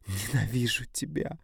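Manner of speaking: slow tearful cry. A narrator's reading. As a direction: say it through tears. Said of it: "плачет она".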